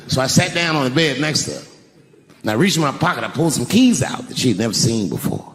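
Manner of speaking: ordinary delivery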